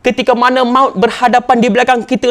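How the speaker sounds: ordinary delivery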